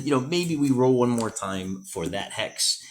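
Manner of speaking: normal talking voice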